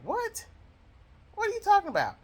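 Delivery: recalling her stunned reply to the funeral director, high incredulous question; incredulous retort. Retold or imitated. Telling how she answered the funeral director, shocked high disbelief.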